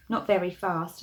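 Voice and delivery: ordinary delivery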